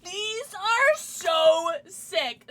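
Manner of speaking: high, loud, drawn-out gushing; excited admiration. Real, not acted. Streamer seeing the gifted stamps, drawn-out excited gushing. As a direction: stretch the words slowly in high delight.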